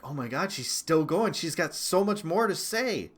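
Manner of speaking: an awed, worked-up retelling; amazed reaction. Retold or imitated. Telling viewers how she kept going, retold amazement.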